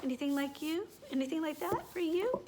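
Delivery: plain reading voice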